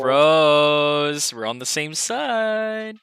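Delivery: drawn-out calling. Pretend. Players chanting across the table.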